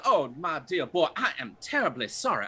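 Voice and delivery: a lightly voiced flustered old gent; fussy gentleman. A hint of gentleman Reggie apologising to the knocked-over boy.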